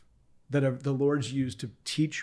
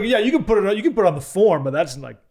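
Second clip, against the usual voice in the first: dismissive wife; 6 semitones higher, 9 dB louder than usual. Retold or imitated. Wife voiced in retold story.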